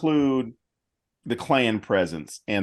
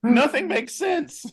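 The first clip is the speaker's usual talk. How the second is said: much higher, slow bewildered voice; baffled professor; slower than usual. Pretend. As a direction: say it slowly, baffled. Committee voice continues.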